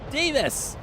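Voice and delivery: greeting a viewer by shout; calling out. Real, not acted. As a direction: call across the room. Calling to a chat member.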